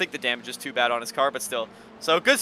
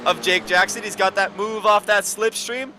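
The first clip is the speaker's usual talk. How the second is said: raised, louder race call, building with the battle; excited play-by-play; 8 semitones higher, 6 dB louder than usual. Real, not acted. Commentator calling a wheel-to-wheel overtake attempt, excited play-by-play. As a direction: drive the commentary up as the fight heats up.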